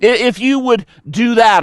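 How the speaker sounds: high, loud whining accusation; mock whiny blame. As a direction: whine and point the finger. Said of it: "Kind of like".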